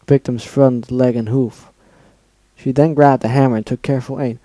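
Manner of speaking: usual speaking voice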